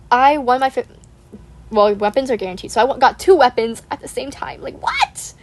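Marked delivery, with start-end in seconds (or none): 4.6-5.3 breathy high whisper of disbelief; squeaky whispered what; stunned whisper. Real, not acted. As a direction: whisper it like you can't believe your luck. Disbelief at lucky pulls.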